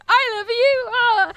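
high loud squeal of love. Retold or imitated. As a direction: squeal it with delight. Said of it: "sent him a video back going".

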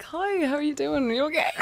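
high, lilting Cork accent; Irish accent. Shown as a demonstration. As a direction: greet them in a sudden high-pitched Irish lilt. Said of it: "anyone who has an Irish accent, I'm just like".

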